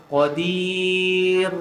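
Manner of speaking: qadir sung with a long stretched a. Retold or imitated. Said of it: "Kadang-kadang dia baca macam mana".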